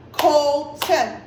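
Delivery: "Colton" split into two even beats, Col-ton; clapped-out syllables. Shown as a demonstration. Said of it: "we clapped for our syllables".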